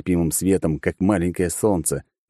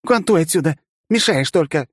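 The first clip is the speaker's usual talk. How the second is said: shooing away; 10 semitones higher, 3 dB louder than usual. Acted. Girl shooing the drone.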